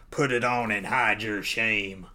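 slow, lightly put-on voice of command; a touch of a cold ruler giving an order; mysterious commanding voice. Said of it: "it was a woman who said that voice".

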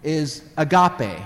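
agape said slowly, a-GAH-pay; Greek word agape. Shown as a demonstration. Teaching the Greek word, agape slowly. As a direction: give agape its Greek sound, slow. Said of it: "the word for love that Jesus uses".

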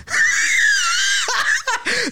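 demonic shriek laugh. Pretend. Demon appears with loud sound.

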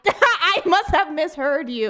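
usual speaking voice

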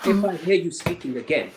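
loud, high-pitched imitated threat; retold scream. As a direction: act out the soldier yelling a warning. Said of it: "and he screamed".

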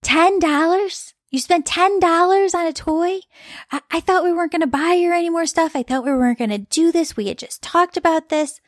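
mock the husband's indignant disbelief over the price; critical husband. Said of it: "He got very critical and he said".

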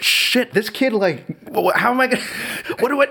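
high, panicky retelling of a new dad; hammed-up new-parent panic; mock panic. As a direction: squeak it out in comic alarm. New dad panicking over baby.

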